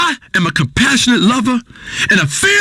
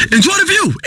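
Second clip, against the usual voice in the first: high-pitched, emphatic urging; high preacher-like exhortation; high exclamation; 8 semitones higher than usual. Acted. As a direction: pitch it well up, then fall. Same words said high, then lower.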